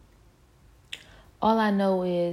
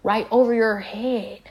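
angry confrontation; 4 semitones higher, 6 dB louder than usual. Real, not acted. Confronting someone who laughed at her.

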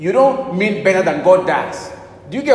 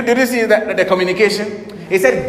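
ordinary delivery

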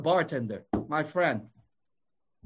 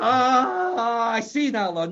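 mock revelation; 3 semitones higher, 3 dB louder, much slower than usual. Mocking a sudden big revelation.

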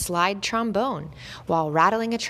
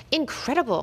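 raised, thrilled exclamation; an entertainer cheering the dog; cried out; 7 semitones higher than usual. A narrator's reading. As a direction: exclaim in delight, pitch lifted. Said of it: "cried the much admired conductor".

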